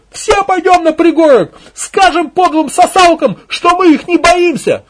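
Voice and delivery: high, loud, slow crowd yell; woman's rallying shout. Acted. Second woman rousing the villagers, shouting. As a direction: back her up at full voice. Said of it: "поддержал другой женский голос".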